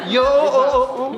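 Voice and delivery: imitated echo. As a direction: copy an echo, repeating the vowel. Host copies the echo sample.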